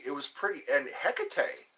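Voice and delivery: usual speaking voice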